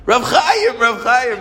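very high, loud starstruck cry; retold excited greeting. Store owner recognizing the rabbi, excited high call. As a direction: call out like a thrilled fan.

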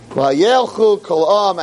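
ordinary talk

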